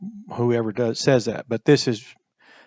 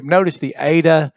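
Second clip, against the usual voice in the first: usual speaking voice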